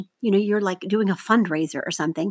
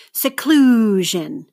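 "Seclusion" slowly, CLU loud and long; stress on second syllable; 5 dB louder, much slower than usual. Shown as a demonstration. Teacher models for seventh graders to mark, loud stressed CLU in "Seclusion". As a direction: say "Seclusion" as se-CLU-sion, punching CLU. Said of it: "circle the stress syllable".

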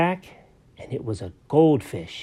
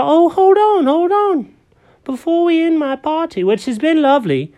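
much higher, louder cat voice playing host; party host kitty; 13 semitones higher, 5 dB louder than usual. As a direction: gush like a proud little birthday cat.

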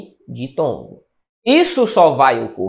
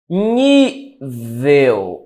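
"Nível" with a long stressed ní, then a lower vel; syllable-by-syllable demo; 4 semitones lower than usual. Shown as a demonstration. Teacher marking the penultimate syllable of Nível, drawn out. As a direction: stretch the ní of "Nível". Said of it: "a sílaba tônica é a penúltima".

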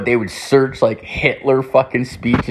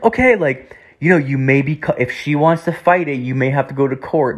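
lightly voiced cop, pitched up; a hint of a police officer explaining, higher; mock officer voice, raised in pitch; imitated cop; 4 semitones higher than usual. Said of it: "statement to the cops".

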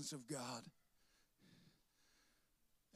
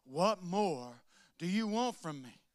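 slow, drawn-out weary plea to God; pleading with God; 3 semitones higher, 6 dB louder, slower than usual. Retold or imitated. Believer complaining to God, slow tired plea. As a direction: stretch it out like you're worn out with God. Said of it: "and said".

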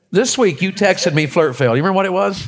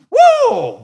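high, loud whoop of a fan; cheer whoop; 5 dB louder than usual. Retold or imitated. Preacher cheering his Tennessee team with a high whoop. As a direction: let out a big fan whoop.

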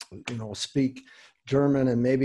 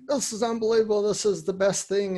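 quoting thrilled people, pitched higher; high, eager retelling of their delight; excited quote; 7 semitones higher, faster than usual. Retold or imitated. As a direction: gush like someone amazed by it. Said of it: "They're running around after a few months saying".

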